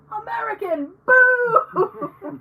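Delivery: high mock heckle building to a loud boo; hammed-up heckler's boo; mock crowd jeer. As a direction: jeer like a hostile audience. Imagined crowd jeering an American.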